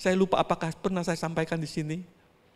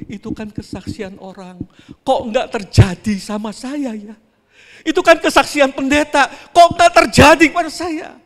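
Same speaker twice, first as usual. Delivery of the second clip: doubting complaint; 6 semitones higher, 10 dB louder than usual. Retold or imitated. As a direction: let the doubt swell into indignation. (keluhan orang ragu yang makin lantang)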